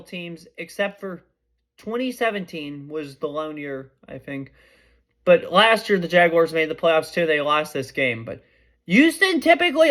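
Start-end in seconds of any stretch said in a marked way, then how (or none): none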